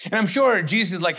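normal talking voice

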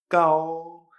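kao held long on a level tone, no puff of air; first tone. Shown as a demonstration. Teacher modelling the example, kao in a sustained first tone. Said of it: "G A O en primer tono".

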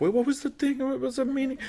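high, soft puzzled voice; mock confusion. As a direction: act baffled, grasping for the word. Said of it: "I'm like".

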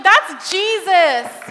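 loud, slow declaration; proclaiming. Retold or imitated. Telling the teens who healed him, loud slow proclaiming. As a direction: announce it with triumph.

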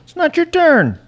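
loud, high playful telling-off; mock scolding. Host telling off an interrupting kid, mock loud scolding. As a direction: scold the interrupter, half in jest.

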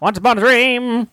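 put-on old-timey movie voice. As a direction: croon it like an old film star. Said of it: "the old-timey movie voice".

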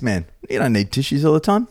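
ordinary delivery

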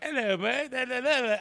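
put-on high-pitched, fumbled British accent; mock British accent. Imitating Rick's actor in interviews, mock British accent. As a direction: fake a British accent with nonsense syllables. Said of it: "I don't know how to do this accent".

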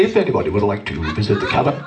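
deadpan steward voice over the intercom; mock flight attendant on the tannoy; airline announcement. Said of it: "they'd say".

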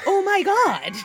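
a sudden loud high exclamation of shock. Real, not acted. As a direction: blurt it out, genuinely stunned. Shocked reaction to axe.

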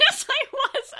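high, loud laughing admission; laughing hard. Real, not acted. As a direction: laugh it out, caught. Admitting joke, laughing hard.

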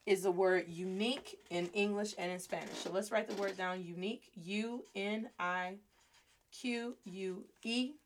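ordinary talk

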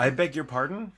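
a hint of a huffy aristocrat; indignant pardon. Shown as a demonstration. Host trying another phrase, mildly prim. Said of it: "That doesn't work the same".

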